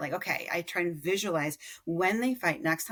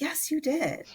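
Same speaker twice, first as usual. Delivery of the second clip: praise; 11 semitones higher, slower than usual. Retold or imitated. Mother praising her son.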